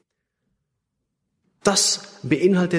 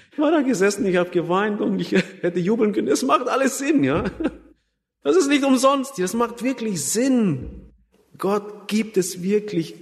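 high, laughing, near-jubilant telling of joy; bursting with remembered joy, much higher; joyful reliving; 7 semitones higher than usual. Retold or imitated. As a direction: relive the moment you wanted to cheer, laughing through it. Reliving joy at finding God.

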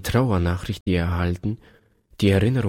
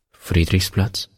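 surprised repeat; slower than usual. A narrator's reading. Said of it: "wiederholte er".

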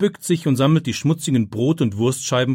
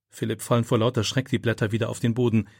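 ordinary delivery

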